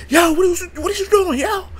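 high, loud angry yell; angry shout. Retold or imitated. Angry customers yelling at the flipping clerk, loud. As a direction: bark it at the clerk in mock outrage. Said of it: "they start getting angry".

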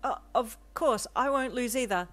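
high, loud flustered reply, firming up; stutter into confidence. A narrator's reading. Deku answers his rival's challenge, stutter turning confident. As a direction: stumble at first, then grow sure. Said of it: "said with a stutter".

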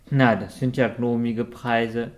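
ordinary delivery, nothing marked

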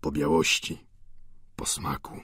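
low, fading reply; subdued old man's low voice; meek old Goriot. A narrator's reading. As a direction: answer humbly, dropping almost to nothing. Old Goriot answers student.

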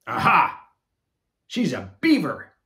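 punk rocker discovery. Acted. Punk rocker announcing her guess at the party, loud triumphant. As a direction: pounce on the idea. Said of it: "decided a punk rocker".

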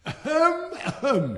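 loud, high throat clearing; loud theatrical ahem; attention cough. A narrator's reading. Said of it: "He always coughed before".

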